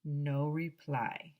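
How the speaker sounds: usual speaking voice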